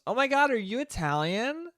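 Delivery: high-pitched mock excited girl; high, rising, thrilled question in imitation; gushing girl. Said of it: "the girl just looks at her and goes".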